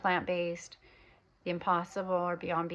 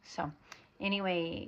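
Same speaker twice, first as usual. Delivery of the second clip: normal talking voice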